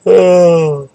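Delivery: a loud yawn on oh; big tired yawn. Real, not acted. Late-night yawning at video end.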